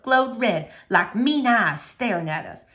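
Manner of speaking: ordinary delivery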